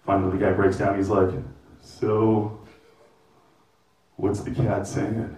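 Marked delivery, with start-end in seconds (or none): 1.8-5.3 low, soft, slow wary question; hesitant stranger. Retold or imitated. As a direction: ask warily, almost afraid to.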